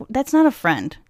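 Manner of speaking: ordinary talk, nothing marked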